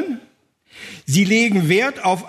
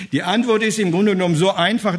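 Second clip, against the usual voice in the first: ordinary talk, nothing marked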